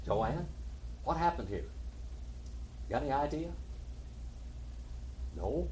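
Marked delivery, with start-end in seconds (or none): none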